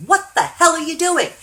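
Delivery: very high, very loud disbelief; imitated surgeon's stunned yell; shocked outburst. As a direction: explode in astonishment at the patient. Surgeon shocked to see client walking.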